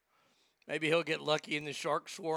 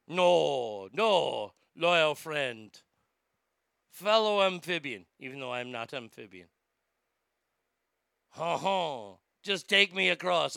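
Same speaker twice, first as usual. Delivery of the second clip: low, smooth mock French accent; 3 semitones lower than usual. Scorpion sweet-talking the frog, low French accent. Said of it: "making the scorpion sound French".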